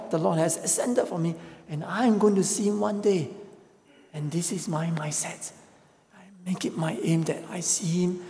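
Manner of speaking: high, earnest preacher's build; fervent preaching. Real, not acted. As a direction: preach it with rising conviction. Preaching with rising force.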